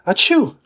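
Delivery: high sneeze. Acted. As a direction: sneeze mid-sentence.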